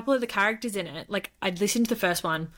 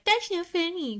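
much higher, put-on complaint; mock protest; 10 semitones higher than usual. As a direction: imitate her objecting in a raised voice. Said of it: "And she's like".

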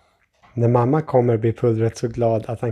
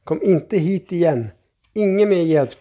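high-pitched, insistent protest; exasperated refusal; 6 semitones higher than usual. A narrator's reading. Grandfather keeping the child away from cooking, exasperated. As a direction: insist you have had enough help.

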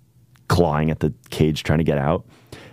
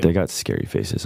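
usual speaking voice

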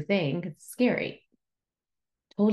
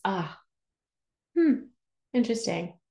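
sarcastic hmm. Real, not acted. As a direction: tease the listener with a pointed hmm. Sarcastic reaction to complaint.

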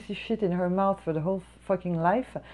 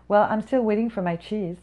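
lightly put-on entitled customer voice, pitched up a bit; mock snooty customer; 4 semitones higher, 4 dB louder than usual. Server mimics the rude customer demanding cheese, mock snooty. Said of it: "She's like".

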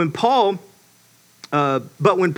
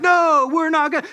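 high, indignant agreement; hammed-up group protest; mock defiant crowd; 9 semitones higher than usual. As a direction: chime in like a riled-up follower. Riled crowd answering the ringleader.

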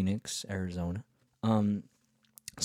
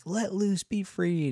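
excited friend voice; 11 semitones higher, 3 dB louder, slower than usual. Retold or imitated. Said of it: "this guy's like".

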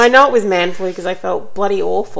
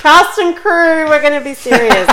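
mock serious; 7 semitones higher, 8 dB louder than usual. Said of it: "we have to be serious now".

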